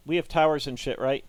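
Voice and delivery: ordinary talk, nothing marked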